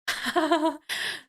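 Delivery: quiet amused laugh; a soft fond chuckle. Real, not acted. Amused by the girl's Cheetos joke, quiet laugh.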